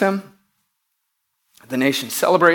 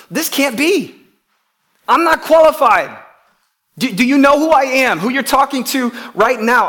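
agitated protest; 7 semitones higher, 5 dB louder than usual. Acted. Saul lists his limitations.

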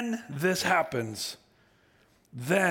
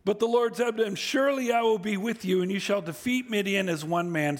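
ordinary talk

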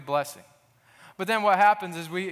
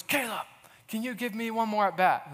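mock-intense coach, pitched up; 5 semitones higher than usual. Little League coach begging the boy to bat, intense. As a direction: lean in hard like a pleading coach. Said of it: "Like, very intense".

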